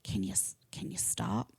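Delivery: whispered under the breath, pleading; hushed aside. Retold or imitated. Narrator whispering to herself while the chatty wife talks. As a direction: mutter it so she can't hear.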